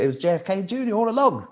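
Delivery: high-pitched cartoon-style unmasking reveal; mock big reveal. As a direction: play a gleeful villain reveal. Voices a Scooby-Doo unmasking reveal.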